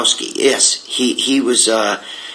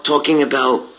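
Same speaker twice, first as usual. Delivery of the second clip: ordinary delivery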